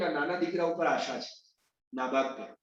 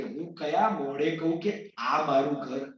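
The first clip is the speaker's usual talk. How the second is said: ashamed, slow sorrow; 6 semitones lower, slower than usual. A narrator's reading. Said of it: "એટલે પડીને રડવા લાગ્યા".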